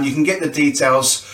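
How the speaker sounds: ordinary talk, nothing marked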